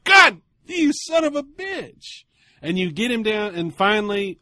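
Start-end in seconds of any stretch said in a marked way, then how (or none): 0.0-2.2 high, loud, slow mock outburst; exasperated curse. Seller cursing the buyer who lowballed him, mock yell.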